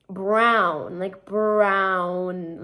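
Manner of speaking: "brown" stretched with a full wow sound; standard pronunciation. Shown as a demonstration. Explaining the proper way, exaggerated ow in "brown". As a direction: draw out "brown", leaning on the w. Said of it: "it's supposed to be pronounced".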